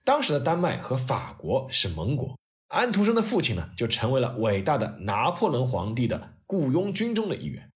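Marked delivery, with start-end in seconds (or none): none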